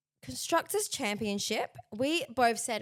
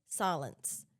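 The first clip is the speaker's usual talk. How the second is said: ordinary talk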